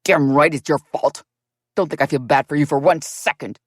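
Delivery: David turns on the narrator; venomous hate. A narrator's reading. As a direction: let the hate drip from each word. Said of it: "Venomous hate filled every word".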